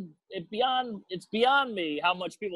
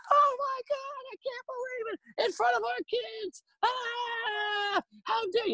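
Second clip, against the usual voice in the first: shrieking mock-outraged wife, much higher; slower than usual. Wife shrieking at her cheating husband in front of guests. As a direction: ham up a scandalized wife's shriek.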